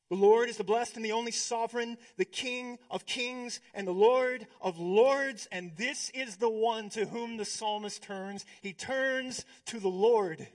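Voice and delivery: loud, ringing proclamation of the King of kings; full preaching. Acted. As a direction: preach it out to the whole church. Proclaiming God's sovereignty.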